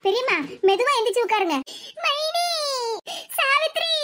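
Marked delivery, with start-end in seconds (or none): 2.0-3.9 high-pitched, drawn-out calling; emotional reunion call. Acted. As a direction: call out longingly to a long-lost relative. Two relatives call out in reunion.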